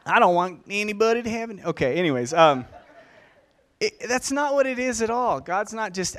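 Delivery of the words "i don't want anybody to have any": louder, quicker, hammed-up Southern accent; Southern accent for God. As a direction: exaggerate the Southern US accent, playfully. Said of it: "I had a southern accent for God".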